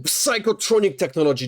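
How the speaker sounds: ordinary talk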